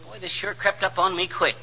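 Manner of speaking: ordinary delivery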